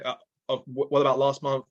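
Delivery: usual speaking voice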